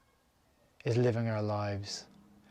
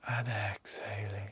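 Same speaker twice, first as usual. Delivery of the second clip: softer, whispered "and exhaling"; 6 dB quieter, slower than usual. Acted. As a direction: let "and exhaling" fade into a whisper. Breath cue during meditation.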